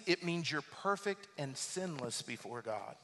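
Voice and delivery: ordinary talk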